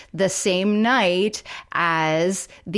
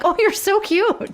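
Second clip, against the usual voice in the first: put-on high cooing baby talk; 13 semitones higher than usual. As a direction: coo it like to a toddler. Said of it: "like, little boy thing".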